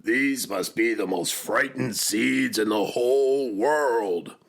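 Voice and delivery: loud, wailing storybook complaint; exasperated cry. A narrator's reading. Toad laments his frightened seeds, loud exasperated cry. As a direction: cry it out to the world, building in volume. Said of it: "cried Toad".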